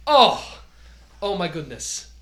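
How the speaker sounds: sudden loud, high gasp of joy; delighted outburst. Acted. [name] jumping up to greet the doctor, thrilled. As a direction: burst out as if meeting a star. Host leaps up greeting guest.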